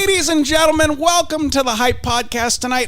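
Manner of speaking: Tucker Carlson announcing. Acted. Tucker Carlson opening the podcast, loud announcer voice. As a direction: boom it like a cable news opener. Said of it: "I am Tucker Carlson".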